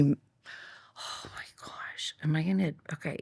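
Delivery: slow, stretched whisper of dread; embarrassed whisper. Real, not acted. Bracing to confess a story to her cohost in a slow whisper. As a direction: whisper it as if you can't believe you'll tell this.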